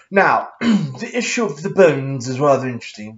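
hammed-up mock-serious lecturer; silly put-on voice. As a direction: put on a pompous, stupid voice. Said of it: "I'm gonna stop this stupid voice".

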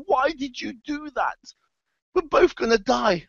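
high-pitched, acted wail of disbelief; hammed-up drowning victim's complaint; dying protest. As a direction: plead in a high voice as you go under. Drowning frog protests to scorpion.

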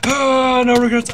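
loud high yell. Real, not acted. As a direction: shout it as you leap. Jumps on purpose, yelling.

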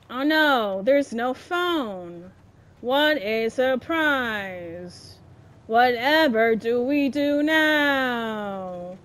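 slow, low deadpan mock dismay; dry sarcasm. Real, not acted. Gamer mocking the predictable game, slow sarcastic drawl. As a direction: drawl it out, unimpressed.

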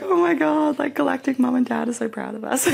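high, excited friend; imitating her friend's excitement. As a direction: gush it like a thrilled friend. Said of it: "she was like".

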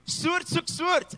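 "Soort soek soort" slowly, high and stretched out; Afrikaans saying, slow. Shown as a demonstration. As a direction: drawl out "Soort soek soort" word by word, loud. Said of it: "It means like finds like".

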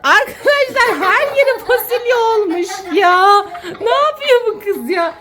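high, drawn-out mock despair; exasperated wail. Real, not acted. Mother tells viewers beans are everywhere, exasperated wail. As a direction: wail helplessly about the mess.

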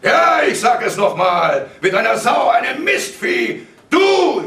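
slow, high, full shout; screaming rage, rising to the end; furious repetition. A narrator's reading. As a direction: hammer out each insult. Father repeats insult in rage.

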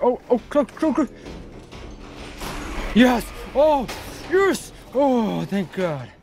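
high triumphant yes; shrieking with relief; excited relief. Real, not acted. Near escape then success.

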